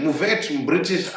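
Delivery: ordinary talk, nothing marked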